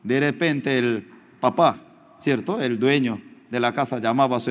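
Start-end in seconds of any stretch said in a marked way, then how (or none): none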